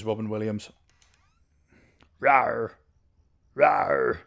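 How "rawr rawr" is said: short growled roar of a game monster; Foxy. Acted. Player doing a monster impression for viewers, playful roar. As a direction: give a playful creature roar. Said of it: "That's my Foxy impression".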